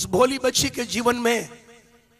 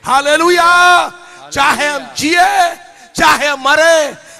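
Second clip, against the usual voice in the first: rising to loud, high shouting, slowing at the peaks; preaching at full force; 5 semitones higher, 10 dB louder than usual. Acted. Preacher proclaims hope in Jesus, building to shouts. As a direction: build until you are crying out to the crowd.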